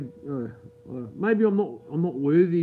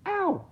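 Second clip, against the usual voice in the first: high-pitched, short "ow"; quick staged yelp from a self-pinch; mock pained yelp. Streamer pinching themself to prove they're no ghost, mock yelp.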